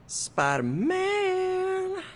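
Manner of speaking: very slow, high drawn-out cry; high hero voice. Shown as a demonstration. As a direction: stretch the name up high. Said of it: "Practice their hero voice".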